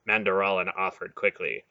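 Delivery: ordinary delivery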